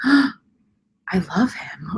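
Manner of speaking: gasp, then a slow, hushed whisper; awed gasping whisper. Retold or imitated. Viewer suddenly falling for a hated character, awed whisper. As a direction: whisper it as a sudden, smitten realization.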